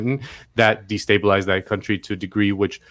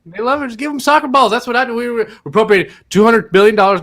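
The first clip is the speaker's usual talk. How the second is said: much higher mock old-president voice; mock Biden; 9 semitones higher than usual. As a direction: ham up a folksy president, pitched up high. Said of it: "I can't do a Biden".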